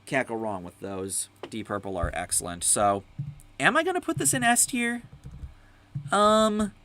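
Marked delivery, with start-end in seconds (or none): 3.6-6.7 high mock question. Mock deliberating over tier.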